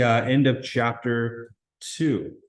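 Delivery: ordinary talk, nothing marked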